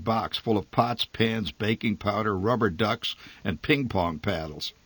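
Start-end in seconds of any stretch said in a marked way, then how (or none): none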